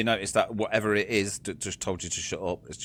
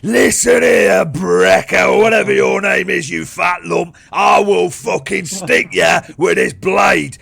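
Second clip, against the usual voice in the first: furious high, drawn-out bellow; emboldened guard shouting; 9 semitones higher, 13 dB louder, slower than usual. Acted. Guard ran back to threaten the prisoner, furious shout. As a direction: roar threats through the cell door. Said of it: "his balls have just got bigger again".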